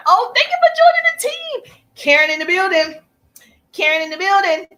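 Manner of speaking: high, loud, thrilled thanks; excited shout-out. Real, not acted. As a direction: cheer a newcomer into the team. Thanking a new member joining live.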